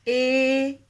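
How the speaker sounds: teacher holding ए long; long vowel demo. Shown as a demonstration. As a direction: sustain ए to the end. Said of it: "बाद तक ए ही आप बोल सकते हैं लंबा".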